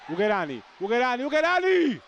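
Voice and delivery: high excited shout of the name; breathless italian goal commentary. Real, not acted. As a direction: cry the name out as the chance flies by. Near miss, commentator cries out.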